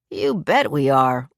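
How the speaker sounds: slow, emphatic "bet"; slow and emphatic grandfatherly assurance. A narrator's reading. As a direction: lean hard on "bet", drawing the words out. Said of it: "Leo said emphatically".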